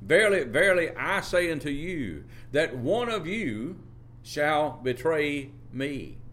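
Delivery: slow, high, ringing pulpit voice; measured preaching of a solemn prophecy; solemn scripture quote. Acted. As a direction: intone the words of Jesus, drawing them out.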